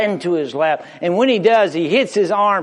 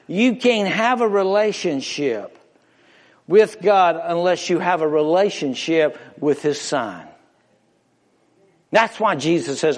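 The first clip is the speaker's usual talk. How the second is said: normal talking voice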